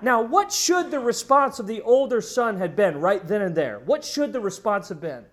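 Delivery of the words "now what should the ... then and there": normal talking voice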